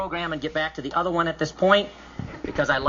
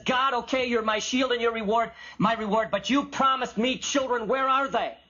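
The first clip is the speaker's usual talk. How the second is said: higher-pitched, pushy challenge to God; chutzpah; 5 semitones higher than usual. Acted. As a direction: argue boldly, pressing for what was promised. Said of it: "Abraham's got chutzpah".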